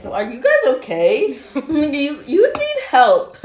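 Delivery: slow, laughing mock concern. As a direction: laugh through the question. Mocking blackout-drunk friends, laughing.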